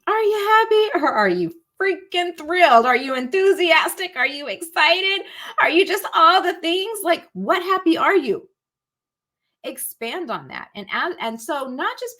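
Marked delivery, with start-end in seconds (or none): 0.0-8.5 higher, louder, pushing each question; excited probing. Real, not acted. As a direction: fire the questions off with growing excitement. Hyping up the listener.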